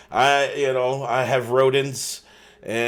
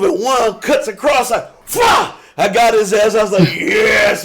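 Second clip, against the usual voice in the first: hyped mock battle cry; 6 semitones higher, 4 dB louder than usual. Acting out the pool stick strike.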